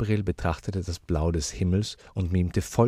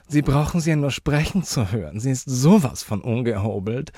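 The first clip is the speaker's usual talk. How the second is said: high, louder, appalled lady; disgusted; 8 semitones higher, 5 dB louder than usual. A narrator's reading. Lady complaining to the uncle about the child, disgusted. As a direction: show lively disgust in a high voice. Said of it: "wie sehr sie das anwiderte".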